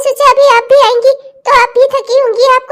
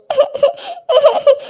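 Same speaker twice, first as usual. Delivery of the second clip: higher burst of crying; acted sobbing; 4 semitones higher, 3 dB quieter than usual. Daughter-in-law offering to leave home, acted sobbing. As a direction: sob helplessly after giving in.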